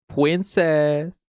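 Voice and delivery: high, loud "pwincess" with r as w. Acted. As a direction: pitch it up into babyish mock devotion. Repeats it in sillier voice.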